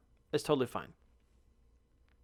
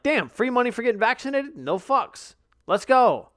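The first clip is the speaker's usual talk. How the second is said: hyped, higher and louder, free money thrill; excited; 7 semitones higher, 4 dB louder than usual. Retold or imitated. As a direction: imitate your first gleeful reaction. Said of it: "at first you're like".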